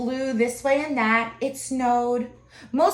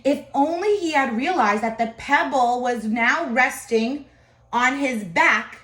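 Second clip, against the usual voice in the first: ordinary delivery, nothing marked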